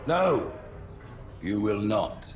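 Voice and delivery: loud, emphatic veto; loud, final ruling; forceful refusal. Acted. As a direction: cut him off with authority. Leader cuts off the commander's plea.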